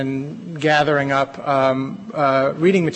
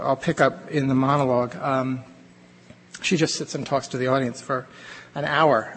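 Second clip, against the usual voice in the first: ordinary talk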